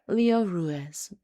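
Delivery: ordinary delivery, nothing marked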